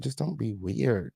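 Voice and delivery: ordinary talk, nothing marked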